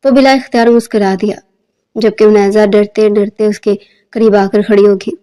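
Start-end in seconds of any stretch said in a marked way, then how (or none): none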